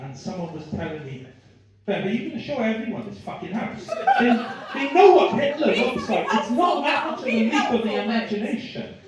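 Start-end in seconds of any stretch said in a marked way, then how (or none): 1.8-9.0 loud laughing fan quote; loud retold fan. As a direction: shout it like an excited fan.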